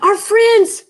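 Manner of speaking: high, loud gasp of alarm. A narrator's reading. Dog gasping at the chip of china. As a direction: catch the breath, horrified. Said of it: "he gasped".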